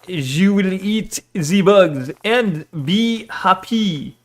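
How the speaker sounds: slow, drawn-out mock French accent. Streamer mocking French globalists to chat, French accent. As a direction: ham up a French accent, stretching each word. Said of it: "French globalists be like".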